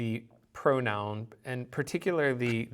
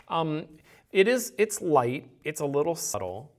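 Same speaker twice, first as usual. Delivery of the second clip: normal talking voice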